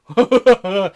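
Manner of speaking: loud barking laugh. Acted. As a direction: bark out a villain's laugh. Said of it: "barking out a laugh".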